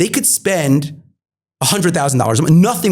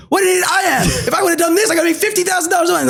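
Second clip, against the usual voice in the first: mock exasperated yell; 11 semitones higher, faster than usual. Founder mocking his past self, exasperated yell. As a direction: shout it like you can't believe your own stupidity.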